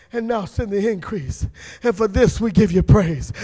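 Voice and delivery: soft intercession. Real, not acted. Preacher quietly praying over the sister. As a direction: plead quietly for her.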